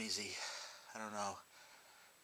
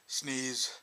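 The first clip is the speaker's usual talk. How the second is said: normal talking voice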